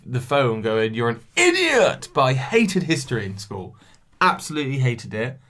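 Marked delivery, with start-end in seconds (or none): 0.9-2.1 high mock yell of idiot; mock viewer yell. Imitating viewers shouting at her, high mock yell. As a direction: play a heckling viewer. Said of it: "you might be screaming at this".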